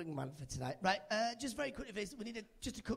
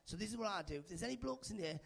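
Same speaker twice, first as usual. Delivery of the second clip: ordinary talk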